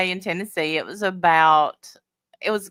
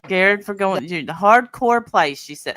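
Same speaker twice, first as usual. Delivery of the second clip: ordinary talk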